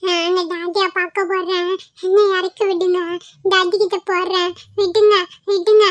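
put-on slow, whimpering child's plea; tearful protest. Child picked up by a stranger wants daddy, whimpering. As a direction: whine to be let go.

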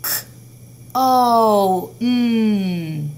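teacher modelling sounds of cone; sounding out. Shown as a demonstration. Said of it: "tell me the sounds of cone".

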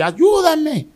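high, loud shout of ayúdame; retold cry for help. A possessed man crying out to his friend for help. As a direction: cry out for help as if the throat just opened. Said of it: "algo soltó mi garganta y yo voceé".

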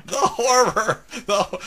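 high, loud hammed-up dying cry; exaggerated horrified wail; mock dying horror. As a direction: play a melodramatic death. Mock dying line in game.

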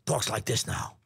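put-on lightly done older Pacino rasp; a hint of today's Pacino; gently imitating the older actor; current Al Pacino. Shown as a demonstration. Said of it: "now Al sounds like this".

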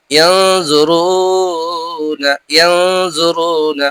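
very slow stretched tajweed words; slow nasal recitation of single Quran words. Shown as a demonstration. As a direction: draw each word out with ghunna. Reciting ikhfa examples.

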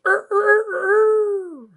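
high, loud crowing call; imitated rooster crow. A narrator's reading. Wendy tries to imitate Peter's crow, high loud crow. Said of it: "trying to imitate Peter's crow".

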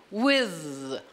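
with with a soft blown TH sound; model soft TH. Shown as a demonstration. Teacher modeling with for students to repeat. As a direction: model with slowly, blowing air through the TH.

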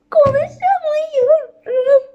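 high, slow, laugh-choked words; can't get the words out for laughing; giggling too hard to speak; embarrassed laughing. Real, not acted. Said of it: "Çok rezil oldum".

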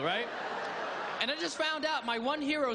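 ordinary delivery

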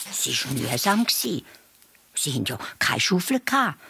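plain reading voice

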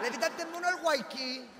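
stoned guy. Acted. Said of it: "papel de volado".

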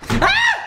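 very high piercing jump-scare shriek; piercing scream. Real, not acted.

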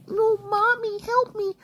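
put-on very high baby-like whimper; mock crying. Player calling for mommy, high mock crying. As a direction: whimper high like a pretend crybaby.

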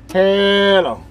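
usual speaking voice

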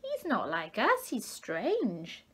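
high, squeaky tadpole voice mocking. A narrator's reading. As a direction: sneer like a tiny tadpole.

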